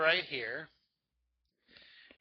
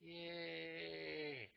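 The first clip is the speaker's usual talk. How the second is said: soft yay; 3 semitones higher, 14 dB quieter than usual. Shown as a demonstration. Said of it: "Take your hand and go".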